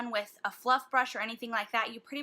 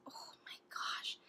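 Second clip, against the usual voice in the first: breathless whispered oh my gosh; hushed, voiceless gasp of wonder; awed whisper; 12 dB quieter than usual. Real, not acted. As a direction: whisper it as if the colour took your breath. Awed by a swatched shade.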